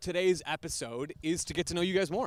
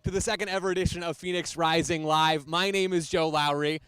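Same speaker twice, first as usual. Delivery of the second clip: punchy, projected welcome to the crowd; live show host intro; 5 dB louder than usual. Real, not acted. Host introducing coach and players to a live audience, projected announcer delivery. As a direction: open the show big, stressing key words.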